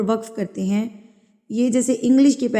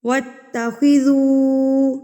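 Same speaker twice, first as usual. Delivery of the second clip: slow, louder tajweed chant; Quran recitation; 4 semitones higher, 4 dB louder, much slower than usual. Shown as a demonstration. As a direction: recite clearly and slowly. (تلاوت کا انداز)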